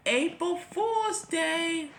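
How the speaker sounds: high, slow, sing-song gloat; gleeful reveal. A narrator's reading. Charlie revealing his April Fools prank to the rescuers. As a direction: draw it out triumphantly.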